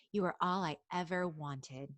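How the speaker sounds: normal talking voice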